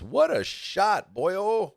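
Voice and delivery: drawn-out high cheer; slow, high, delighted exclamation; excited. Real, not acted. Cheering a great shot.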